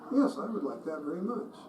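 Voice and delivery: soft, sweet and gracious reply; sweetly and softly. Retold or imitated. Speaker answers an angry protester, very sweetly and softly. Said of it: "Very sweetly and softly".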